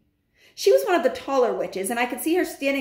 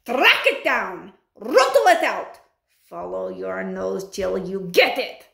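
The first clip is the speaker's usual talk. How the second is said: commanding witch; 4 dB louder than usual. Acted. Grand High Witch ordering hunt.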